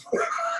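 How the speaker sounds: high squeaky laugh. Pretend. Mocking the helper's despair with a squeaky laugh. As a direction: let out a sharp high laugh. Laugh before mock sob.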